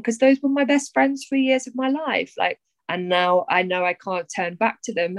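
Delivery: usual speaking voice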